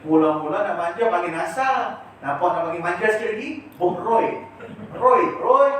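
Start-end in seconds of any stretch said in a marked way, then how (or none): 3.7-5.7 louder, higher call of Roy; calling a nickname. Retold or imitated. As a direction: call Roy like a fond parent.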